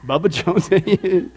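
laughing. Real, not acted. Laughing over the joke name.

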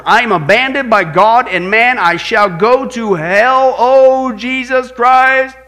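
loud, drawn-out anguished cry, slowing to a wail; despairing last words. Retold or imitated. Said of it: "this is his last words".

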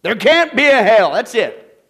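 loud, high mock protest; hammed-up shouted denial; imitated stubborn objection. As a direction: play a man flatly refusing to believe. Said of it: "you know what Bob's big hang-up was?".

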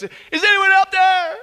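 high, loud cry for help; terrified calling out. Acted. Tourist hanging off a cliff calls out toward heaven, terrified shout. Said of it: "he's filled with terror, and so he calls out".

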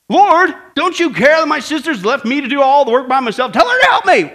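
high, put-upon plea rising to a shout; complaining Martha. Acted. Martha tattles to the Lord on her sister, high loud complaint. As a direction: whine upward, ending in a loud demand.

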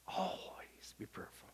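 slow whisper; whispered sermon refrain. Shown as a demonstration. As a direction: breathe the words like a secret.

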